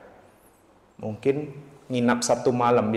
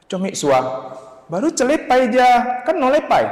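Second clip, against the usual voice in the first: high, louder Hokkien-flavoured chatter; voicing the older sister; 12 semitones higher, 5 dB louder than usual. Older sister telling him about the monk, raised and loud.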